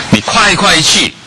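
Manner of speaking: high, loud, slow push; urging. Acted. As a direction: spur them to hurry. Urging eager action.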